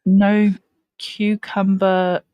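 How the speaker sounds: very slow, drawn-out delivery; deliberately stretched-out words; careful substitute wording. Pretend. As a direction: pick each word out slowly, as a joking euphemism. Mock-careful substitute for rude words.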